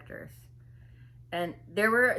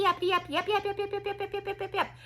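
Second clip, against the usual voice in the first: very high, relentless yapping drone; wordless yapping imitation; 11 semitones higher, faster than usual. Her talk no longer even words, mock yapping. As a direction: drone yaps on one high note, fading slightly. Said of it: "It wasn't even words anymore".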